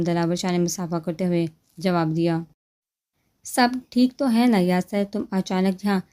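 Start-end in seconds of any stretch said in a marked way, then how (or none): none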